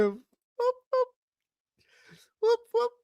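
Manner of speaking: very high, short whoop noises; high-pitched cheering whoops; celebratory whoops. Real, not acted. Celebrating the rising count.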